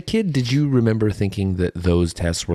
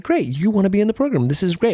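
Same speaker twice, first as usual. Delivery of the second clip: higher, quick mock-excited office ladies; imitating the ladies; 12 semitones higher, faster than usual. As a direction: gush like the delighted department ladies. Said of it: "They were like".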